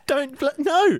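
high, loud mock plea; mock panic. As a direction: beg him to stop in fake fright. Skit being threatened.

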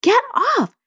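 high-pitched mock yell; retold angry yell. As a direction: imitate an exasperated parent yelling, without full volume. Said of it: "I'd get really angry and I would yell".

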